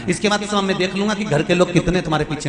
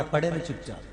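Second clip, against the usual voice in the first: low-key, much softer aside; hushed warning; 3 semitones lower, 10 dB quieter than usual. Retold or imitated. Guru secretly warns the merchant to stay down, hushed. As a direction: quietly order someone to lie still.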